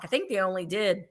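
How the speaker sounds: normal talking voice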